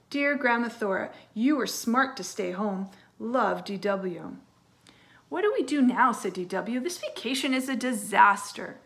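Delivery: lively storybook read-aloud; reading to children. Acted. Teacher reading a story to her class, lively storytelling. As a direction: read it brightly to young kids. Reads a picture book aloud.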